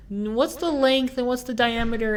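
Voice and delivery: imitated old lady, high voice. Old lady on the phone asking her daughter, high imitated voice. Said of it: "she's asking her daughter".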